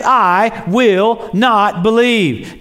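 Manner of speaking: each word slowed and pressed down; a stubborn Thomas spelling it out; stubborn refusal. Retold or imitated. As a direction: dig in your heels, very slow.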